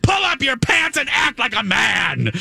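high, mock-furious yell; imitated screaming. Imitating her ordering him to act grown-up, screaming. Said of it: "She's, like, screaming".

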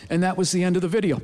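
low, matter-of-fact wrap-up; deadpan ending. Real, not acted. Comic ends the sad gorilla story, low and deadpan. Deadpan punchline after the crying.

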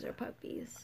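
breathy whisper. Acted. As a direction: whisper it playfully. Teasing whisper about puppies.

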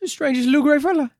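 high, quick British voice; mock UK accent. Podcaster doing a silly British accent, high and fast. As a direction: ham up a British storyteller. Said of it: "our various UK accents".

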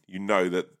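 ordinary talk

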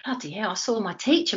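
ordinary delivery

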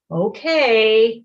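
loud, drawn-out sarcastic okay; exaggerated grudging agreement. A narrator's reading. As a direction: give in with heavy teenage sarcasm. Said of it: "really sarcastically".

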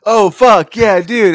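loud, high outburst of dismay; sudden realization. Real, not acted. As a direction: caught out, blurting it loud. Remembering forgotten bit.

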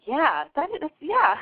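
lightly mimicked vague agreement; imitating the caller. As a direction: parrot her half-hearted yeah. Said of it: "you just be like".

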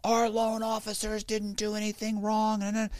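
high, slow, indignant put-on voice; mock screaming bank man. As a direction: defend the bank, high and huffy. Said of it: "so he's screaming".

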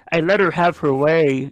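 lightly imitating the author's voice; a light put-on impression of the writer; Jordan Peterson impression. Quoting the writer's audio to co-hosts, lightly mimicked voice. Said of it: "in his voice".

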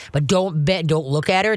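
ordinary talk, nothing marked

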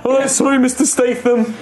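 high, meek apology; apologetic cyclist. Acted. Cyclist apologising to Statham, high and meek. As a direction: grovel to him. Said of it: "It's like".

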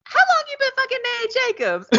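high-pitched, rapid mock accusation; mock blurted accusation. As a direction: blurt the question out high and fast. Rue's blurted question, acted out.

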